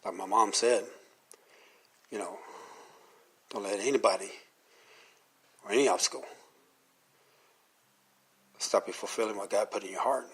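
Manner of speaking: ordinary talk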